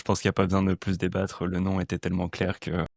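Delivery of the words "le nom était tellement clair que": usual speaking voice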